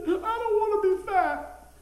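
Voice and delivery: put-on high drawn-out child's whine; crying child. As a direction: wail it like a hurt little boy.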